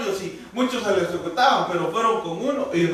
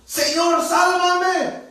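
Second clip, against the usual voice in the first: much higher, louder, a sinking man crying out; frightened cry for help; 12 semitones higher, 4 dB louder, much slower than usual. A narrator's reading. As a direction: cry out to the Lord as you sink. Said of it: "gritó diciendo". (grito angustiado de Pedro hundiéndose)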